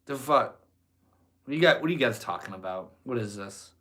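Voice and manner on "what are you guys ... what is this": usual speaking voice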